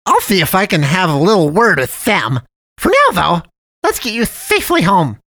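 sugary high cartoon voice; jolly cat man. A narrator's reading. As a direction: play the friendly dump cat, overly sweet. Cat man promises help.

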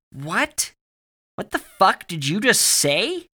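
high, loud bleat of disbelief, slowing down. A narrator's reading. Barry hears Alex mention a child laughing, bleating. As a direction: bleat it out, rattled and rising. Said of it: "Barry bleated out".

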